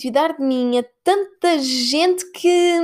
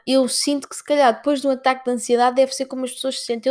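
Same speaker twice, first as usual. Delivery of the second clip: normal talking voice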